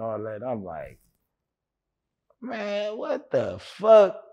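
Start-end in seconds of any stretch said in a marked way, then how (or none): 2.4-4.2 high, drawn-out incredulous exclamation; re-enacted shock, slow and high-pitched; retold disbelief. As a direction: stretch it out high, stunned. Retells shocked disbelief at the news.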